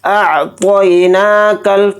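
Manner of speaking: अअतैनाकल with a thick, heavy त्वा. Shown as a demonstration. Teacher demonstrating heavy त्वा, slow and emphatic. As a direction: say the त्वा in अअतैनाकल full-mouthed, not thin. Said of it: "त्वा को यहाँ पर मोटा पढ़ेंगे".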